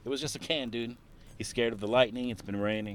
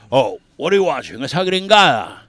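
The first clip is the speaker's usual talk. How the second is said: dad voice; 4 semitones higher, 11 dB louder than usual. Retold or imitated. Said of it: "My dad would always be like".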